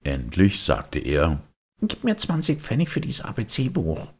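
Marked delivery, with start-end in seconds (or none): 1.8-4.1 put-on high, longing puppet boy; a boy pulled toward the music; wistful high boyish voice; Bengele's voice. Bengele sells his book.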